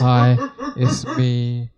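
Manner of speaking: very slow, low seductive hello; imitating a sexy deep voice. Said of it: "sexy Valentine voice on".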